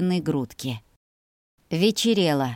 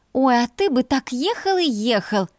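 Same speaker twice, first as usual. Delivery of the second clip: higher-pitched teasing jab; snide; 6 semitones higher than usual. A narrator's reading. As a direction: needle your brother sarcastically. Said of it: "съязвила я".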